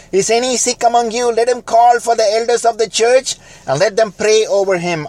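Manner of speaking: high, loud scripture read like a proclamation; preached scripture. Acted. Preacher proclaiming James to the church, high and loud. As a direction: proclaim the verse to the pews.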